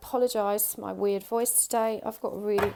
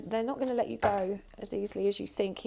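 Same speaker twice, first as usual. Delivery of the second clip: ordinary talk, nothing marked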